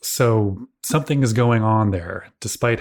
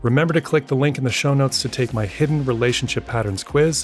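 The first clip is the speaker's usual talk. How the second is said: brisk, upbeat outro read, a touch higher; podcast outro; 3 semitones higher than usual. Acted. Host thanking listeners over music, in a brisk upbeat outro read. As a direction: read the sign-off like a friendly host.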